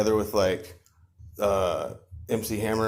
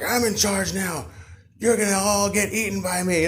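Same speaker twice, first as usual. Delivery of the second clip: domineering toad; 10 semitones higher than usual. Acted. Toad taking over the kingdom, menacing boast. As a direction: declare it as a hammed-up bully toad.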